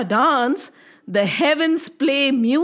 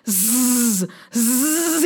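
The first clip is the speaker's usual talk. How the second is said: long buzzing "zzz" hiss; serpent hiss; 2 semitones lower than usual. Acted. Storyteller voices the angry serpent, hissing. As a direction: make a drawn-out snake hiss. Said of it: "he went".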